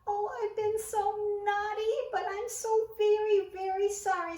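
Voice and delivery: high remorseful sobbing plea; tearful cry. A narrator's reading. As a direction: plead through tears. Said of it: "cried Christopher".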